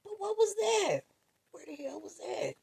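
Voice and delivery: high, baffled questions; high bewilderment. Retold or imitated. Waking from the dream, high baffled questions. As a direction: ask it stunned and high. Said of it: "I was like".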